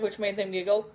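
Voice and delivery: plain reading voice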